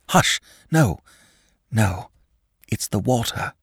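shivering, teeth chattering. A narrator's reading. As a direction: speak through chattering teeth. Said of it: "with chattering teeth".

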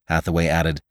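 usual speaking voice

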